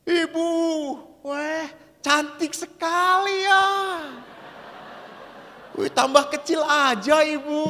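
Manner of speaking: high, slow honeyed flattery; old priest sweet-talking a lady, high and slow; old pastor flattering. Retold or imitated. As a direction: lay on the charm to the lady, drawn out. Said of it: "pastor itu sudah berkata".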